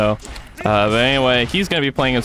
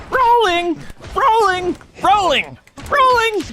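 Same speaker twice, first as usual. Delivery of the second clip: Annie's rolling voice line. Retold or imitated. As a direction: squeal it like a game voice line. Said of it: "she'd always go".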